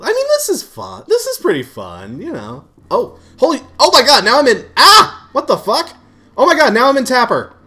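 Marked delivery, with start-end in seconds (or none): 2.9-7.5 high, loud shock; real startled outburst; amazed yelling. Real, not acted. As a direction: cry out in disbelief at the game. Amazed by sudden game change.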